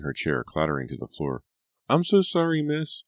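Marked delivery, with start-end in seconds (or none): 1.9-3.0 very high, loud panicked apology; a maid terrified she spilled the soup; frightened apology. A narrator's reading. Maid apologising to the scalded lady, high and frightened. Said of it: "Lucy cried".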